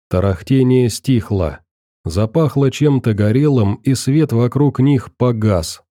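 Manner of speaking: usual speaking voice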